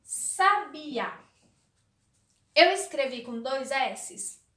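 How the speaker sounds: ordinary talk, nothing marked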